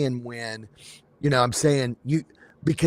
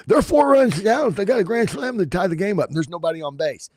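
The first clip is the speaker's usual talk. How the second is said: Harry Carey impression; 6 semitones higher than usual. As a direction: belt it like a tipsy ballpark announcer. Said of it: "Yeah, Harry Carey".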